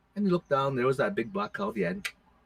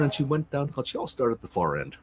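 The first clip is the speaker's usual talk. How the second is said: ordinary delivery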